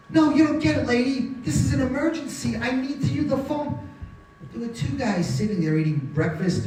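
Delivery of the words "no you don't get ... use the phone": high-pitched, insistent plea; acted desperation in a raised pitch; urgent insistence. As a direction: insist this cannot wait.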